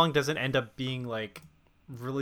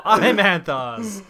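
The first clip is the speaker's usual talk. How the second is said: ordinary talk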